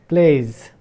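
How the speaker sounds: plays with the final s voiced as a clear buzzing z; Z sound in plays. Shown as a demonstration. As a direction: stress the z buzz at the end of plays. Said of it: "this Z".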